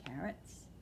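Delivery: soft unvoiced carrots; whispered aside. Old lady confiding about carrots, whispered. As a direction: breathe the word like a secret. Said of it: "whispered aside".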